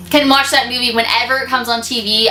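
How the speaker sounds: ordinary delivery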